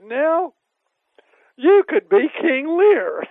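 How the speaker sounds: loud, high and slow, so the room hears; teasing a deaf friend loudly in front of relatives. Retold or imitated. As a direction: announce it loudly for all. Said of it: "I said very loudly".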